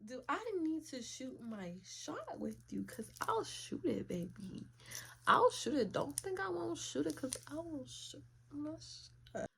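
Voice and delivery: very soft, half-whispered come-on; hushed, teasing flirtation; flirty hush. Pretend. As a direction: purr it playfully, barely above a whisper. Playing flirty aside to celebrity.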